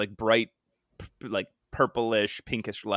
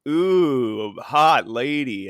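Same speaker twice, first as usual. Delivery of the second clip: slow, louder mock-smitten drawl; smitten ogling; 7 dB louder, much slower than usual. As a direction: ogle aloud, drawing it out. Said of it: "start drooling or whatever".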